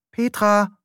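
calling out. Acted. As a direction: call her name to rouse her.

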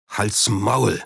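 restrained, grumbling threat; rumbling growl. A narrator's reading. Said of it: "sagte er mit grollender Stimme".

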